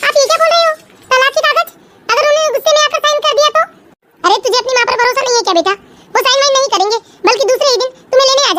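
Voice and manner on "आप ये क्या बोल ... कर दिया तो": higher-pitched, alarmed questioning; worried daughter's startled questions; shocked alarm. Acted. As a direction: react aghast to mother's plan. Daughter shocked at divorce papers plan.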